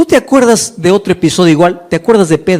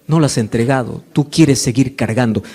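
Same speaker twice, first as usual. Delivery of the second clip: God speaking softly; 11 semitones lower, 6 dB quieter than usual. Pretend. God's voice rebuking believer.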